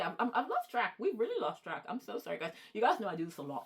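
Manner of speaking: ordinary talk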